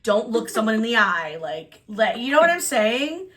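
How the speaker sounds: usual speaking voice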